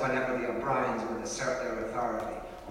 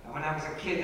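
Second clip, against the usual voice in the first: ordinary delivery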